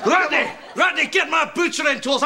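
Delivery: high, heated shout for the employee; enraged order. Acted. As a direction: summon your helper for a fight. Enraged boss calls for weapons.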